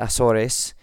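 Azores as ah-SOR-ess; three syllables in Azores, with S sounds; Spanish pronunciation. Shown as a demonstration. Podcaster reading listener's comment, Spanish-style Azores. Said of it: "if we're speaking Spanish".

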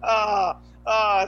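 loud, high mock howls of laughter; hammed-up helpless cackling; imitated hysterical laughing. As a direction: imitate mom losing it, loudly. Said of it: "she just lost her mind laughing".

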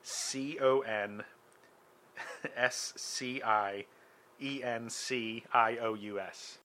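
very slow letter by letter; spelling it out. Shown as a demonstration. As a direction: spell each letter, slowly. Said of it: "this is not how you spell it".

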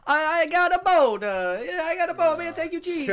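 put-on high, loud broken-English Italian accent. GM voicing a local offering a cheap boat, hammed Italian accent. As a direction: ham up an Italian boatman's accent. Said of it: "you don't have to do the accent".

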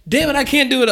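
overwhelmed half-joking wail; frustrated outburst. Retold or imitated. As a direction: throw up your hands and cry it out. Retold frustration under orders.

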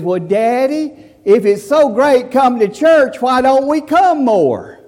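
put-on high, slow little boy asking; child's voice. Little Johnny asks his father on the drive home, high childlike voice. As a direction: sound like a curious little kid. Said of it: "little Johnny says".

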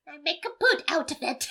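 hammy Irish imitation; mock Irish accent. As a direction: do a poor Irish leprechaun accent. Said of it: "Irish accent is your Irish accent".

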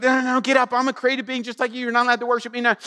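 high, hurried mock-angel protest; acted-out alarmed refusal; urgent protest.

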